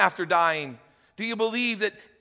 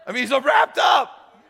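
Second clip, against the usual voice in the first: loud, higher, animated; excited preaching; 7 semitones higher, 7 dB louder than usual. Real, not acted. Pastor to the congregation, getting loud and excited. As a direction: build the excitement up.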